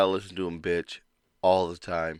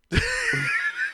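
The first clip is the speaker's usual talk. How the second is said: high laugh; 5 dB quieter than usual. Real, not acted. Bursts out laughing.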